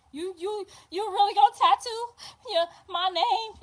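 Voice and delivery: high, crying question; tearful disbelief. Retold or imitated. Mother in labour asking through tears about the tattoo. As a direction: sob out the question. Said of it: "she said".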